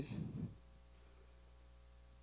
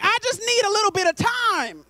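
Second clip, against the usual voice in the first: higher-pitched pleading God voice; 5 semitones higher than usual. Acted. God begging his distracted people for attention, pleading. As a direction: plead to be noticed by someone ignoring you. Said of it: "God is like".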